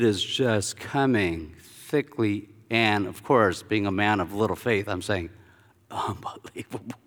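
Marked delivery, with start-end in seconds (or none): 5.9-7.0 very soft, barely voiced murmur; hushed disbelief. Man of little faith overwhelmed by anointing, hushed disbelief. Retold awestruck murmur under hot oil.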